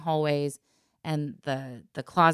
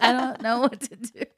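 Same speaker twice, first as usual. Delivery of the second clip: helpless whine; 7 semitones higher, 4 dB louder than usual. Retold or imitated. Sister helpless on floor.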